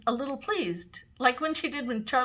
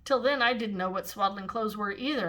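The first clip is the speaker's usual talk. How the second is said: ordinary delivery, nothing marked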